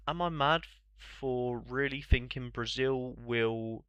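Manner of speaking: ordinary delivery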